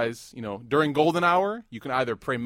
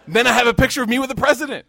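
higher and louder, laughing as you speak; laughing through it; 12 semitones higher, 13 dB louder, faster than usual. Real, not acted. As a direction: laugh through the friend's excuse. Retelling friend's excuse, laughing.

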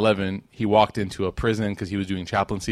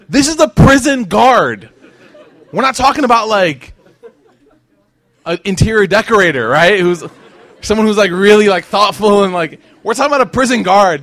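much louder and higher, incredulous; amazed exclaiming; 8 semitones higher, 11 dB louder than usual. Real, not acted. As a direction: marvel loudly at the tough guard. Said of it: "exclaims about the guard".

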